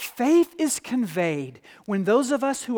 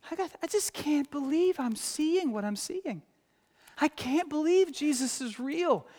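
doubting disciple; 7 semitones higher than usual. Retold or imitated. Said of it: "more than one disciple who is saying".

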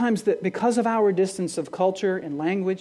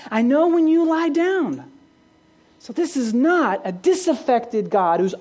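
louder, higher, building sermon drive; impassioned preaching; 4 semitones higher, 4 dB louder than usual. Acted. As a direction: preach it with rising fire. Preacher builds to full force.

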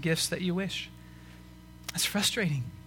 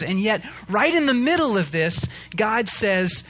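louder, higher preaching build; impassioned pulpit voice, louder; preaching at full force; 4 semitones higher, 4 dB louder than usual. Acted. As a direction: rouse the congregation about the exile. Sermon rising to full force.

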